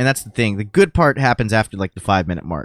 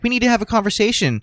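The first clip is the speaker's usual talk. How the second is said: higher, fast prissy mimic; mock lady; 10 semitones higher, faster than usual. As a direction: imitate the earnest lady. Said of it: "that same lady that was like".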